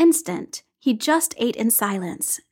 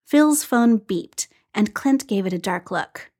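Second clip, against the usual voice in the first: ordinary delivery, nothing marked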